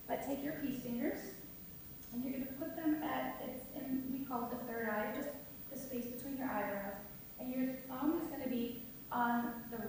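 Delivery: very soft, hushed meditation instruction; guided breathing exercise. Real, not acted. Yoga teacher guiding the group through alternate nostril breathing, very softly. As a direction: lead them gently through each breath, barely audible.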